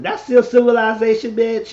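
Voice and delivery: high, loud scolding retort; heated comeback. Retold or imitated. Friend scolding Eddie over his manners, heated.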